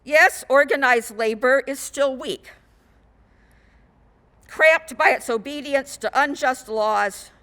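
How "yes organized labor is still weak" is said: ordinary talk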